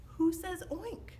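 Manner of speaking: ordinary delivery, nothing marked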